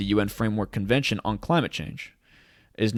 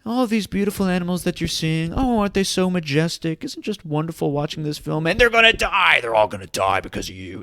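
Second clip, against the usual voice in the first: mock British documentary narrator; 7 semitones higher than usual. A mocked British nature-film narrator blaming the viewer, high British accent. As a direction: gush in British English like an awestruck nature host, then drop the doom. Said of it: "that exquisite British voice".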